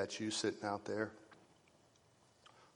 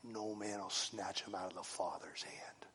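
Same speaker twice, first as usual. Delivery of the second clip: much softer whispered promise; 9 dB quieter than usual. Real, not acted. Preacher whispering God's promise to his flock. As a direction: whisper it like a secret assurance.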